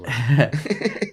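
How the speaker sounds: slow, drawn-out teasing with a rising end; ribbing a friend about his arrest; mock skeptical echo. Real, not acted. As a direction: stretch it out like you don't buy it.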